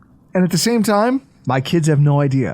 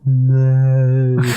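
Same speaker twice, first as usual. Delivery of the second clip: mocking milk; 3 semitones lower than usual. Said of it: "Say it one more time slowly".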